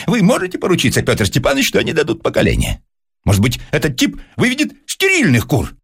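high, heated rhetorical questioning; carried away. A narrator's reading. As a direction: press the point excitedly. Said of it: "Персиков вошёл в азарт". (с азартом, на высоких нотах)

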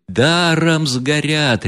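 mild roar, high and stretched; crowd roaring. A narrator's reading. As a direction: lament the waste at full voice. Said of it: "ревели со всех сторон".

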